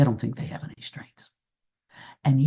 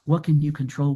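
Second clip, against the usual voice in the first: ordinary talk, nothing marked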